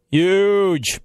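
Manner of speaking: lightly voiced Trump saying huge; a hint of Trump; Trump impression. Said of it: "my Trump impersonation".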